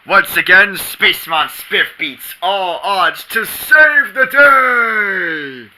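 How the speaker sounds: slow triumphant announcer shout; heroic announcer shout. Acted. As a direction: proclaim the hero's victory.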